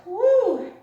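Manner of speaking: high, loud woo of relief; loud whoop. Real, not acted. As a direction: whoop loudly as the class rests. Cheer at child's pose.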